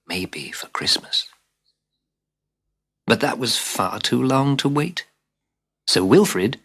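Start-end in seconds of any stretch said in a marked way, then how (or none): 0.1-1.3 softly whispered mother's reply. Acted. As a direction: brush him off in a hush. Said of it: "His mother only said".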